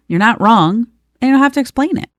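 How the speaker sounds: usual speaking voice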